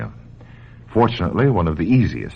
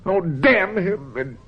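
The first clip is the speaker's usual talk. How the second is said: angry outburst. Acted. Doctor cursing the paperweight, angry and shrill with rage. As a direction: spit out the curse, voice rising to a pitch.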